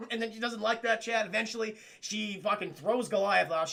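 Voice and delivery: ordinary talk, nothing marked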